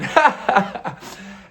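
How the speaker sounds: breathy burst of laughter; real laugh between lines of the story. Real, not acted. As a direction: crack up at the memory. Laughs at his own story.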